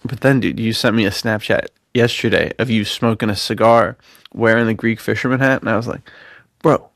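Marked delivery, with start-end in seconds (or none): none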